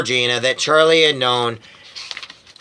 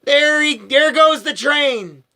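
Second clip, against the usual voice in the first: high, slow grieving wail; 12 semitones higher, slower than usual. A narrator's reading. Grandma wailing as she watches the train, high and slow. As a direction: wail it out slowly. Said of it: "she wailed".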